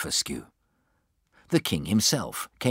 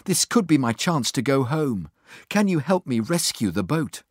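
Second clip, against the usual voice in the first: higher-pitched, earnest plea; begging; 7 semitones higher than usual. A narrator's reading. Gulliver asking for help to rescue the boat, pleading. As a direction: press the request, pitch lifted. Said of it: "he begged".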